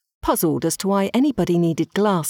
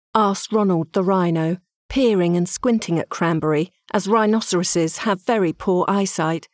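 ordinary delivery

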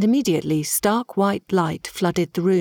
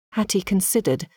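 usual speaking voice

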